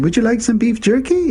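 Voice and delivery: high, put-on African accent; crazy African accent. Exchange student offering Beaks a snack, crazy African accent. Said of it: "he's putting on this crazy African accent".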